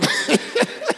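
airy, high-pitched chuckle; high breathy laugh. Real, not acted. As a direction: laugh it off lightly. Pastor laughs at his own story.

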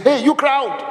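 high-pitched call to a crowd. Retold or imitated. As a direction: hail the crowd from afar, pitched well up. Acting Jesus calling to the crowd.

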